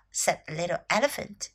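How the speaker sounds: ordinary delivery, nothing marked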